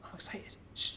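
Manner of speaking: breathy whispered excitement. Acted. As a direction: whisper it to your neighbour. Whispering in audience.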